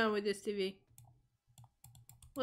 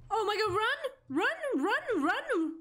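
panicked shout; 9 semitones higher than usual. Real, not acted. Chased by the monster, yelling to run. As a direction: scream at yourself to flee.

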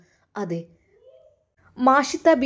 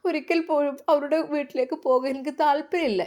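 put-on higher, tearful girl's plea; pleading girl; 5 semitones higher than usual. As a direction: beg her to save you. Hamda pleads not to return. (പേടിച്ച പെൺകുട്ടിയുടെ അപേക്ഷ)

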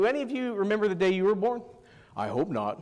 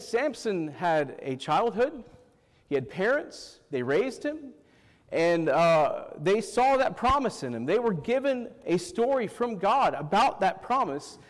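ordinary talk

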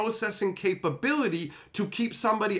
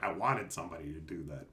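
quiet aside; 13 dB quieter, faster than usual. Retold or imitated. Random person quoted.

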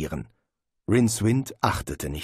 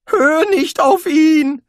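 high, loud, slow frantic cry; shouting; 10 dB louder, slower than usual. A narrator's reading. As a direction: yell it in panic. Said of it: "rief er".

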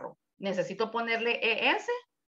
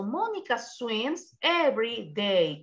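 slow, careful Mónica swims every day; slow model reading; slower than usual. Shown as a demonstration. Teacher modelling the sentence slowly for students. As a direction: read it slowly for the class. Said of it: "O en este caso".

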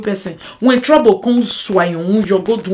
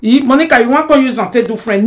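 usual speaking voice

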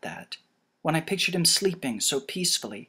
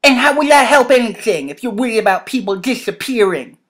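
loud, raised exasperated character voice; heated, high-energy challenge; Jacob, angry; 8 semitones higher, 12 dB louder than usual. Acted. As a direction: throw the question back forcefully.

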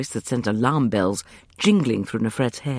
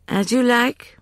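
Justin's reply; 11 semitones higher, 5 dB louder, slower than usual. A narrator's reading. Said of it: "Justin said".

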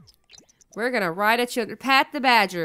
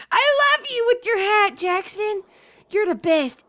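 gushing; 8 semitones higher than usual. Real, not acted. Gushing over sim's hat.

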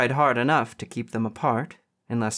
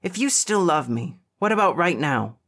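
high, urgent dare; fired-up, insistent question; defiant challenge; 5 semitones higher, 3 dB louder than usual. A narrator's reading. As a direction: dare him, pitched up. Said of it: "his eyes catching fire".

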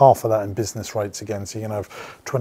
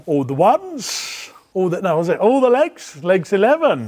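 drawn-out bingo call, higher and louder; bingo caller; 6 semitones higher, 6 dB louder than usual. Pretend. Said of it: "Always wanted to do this job".

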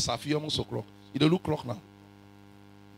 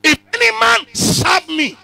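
high, loud, slow scripture cry; preaching shout; 13 semitones higher, 11 dB louder, slower than usual. Acted. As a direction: proclaim the verse slowly.

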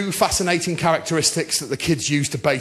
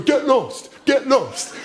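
embarrassed refusal; 7 semitones higher, slower than usual. Retold or imitated.

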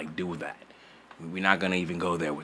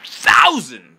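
loud; 4 semitones higher, 10 dB louder than usual. Real, not acted. Hyped subscriber count.

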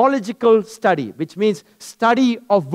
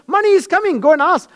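high voice of God; 8 semitones higher, faster than usual. Acted. Voices God answering him.

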